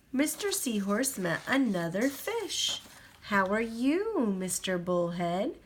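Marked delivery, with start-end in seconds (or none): none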